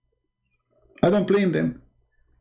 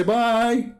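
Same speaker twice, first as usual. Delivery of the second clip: high, drawn-out mock goodbye; cheery farewell; 7 semitones higher than usual. People leaving the elevator away from him, mock-cheery goodbye. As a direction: imitate relieved people singing out a goodbye.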